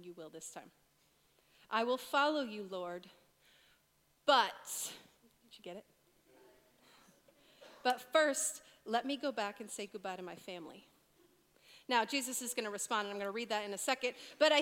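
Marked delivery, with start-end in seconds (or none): 1.7-10.9 but set apart, pause after it; stress on but. Shown as a demonstration. Preacher rereads a verse, leaning hard on but. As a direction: lean on the word "but" and pause. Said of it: "the word that I'm emphasizing".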